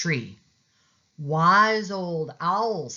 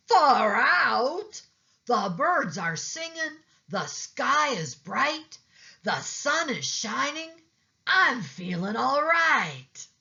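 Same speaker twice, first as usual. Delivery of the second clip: delighted turtle; 11 semitones higher than usual. A narrator's reading. Turtle amazed by the sunglasses, high and happy. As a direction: marvel at everything you see.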